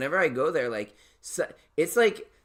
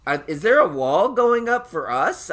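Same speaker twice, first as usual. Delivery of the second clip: higher-pitched incredulous questions; mock indignation; 6 semitones higher, 6 dB louder than usual. Reacting to a guest keeping count, mock indignant disbelief. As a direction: act out baffled, comic outrage.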